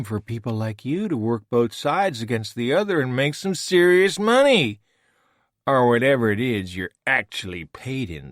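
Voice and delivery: put-on a smooth, superior schemer taunting an old foe; Voke, smug villain. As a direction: lecture him with mocking disdain. Narrator voices Voke.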